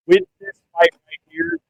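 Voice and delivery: breaking up over a bad line; bad connection. Real, not acted. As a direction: voice cutting out on a call. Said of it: "you're cutting out".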